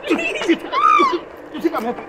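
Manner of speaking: furious yelling. Acted. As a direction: rage at her, out of control. Man yelling furiously at woman.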